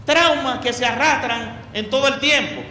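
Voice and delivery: ordinary talk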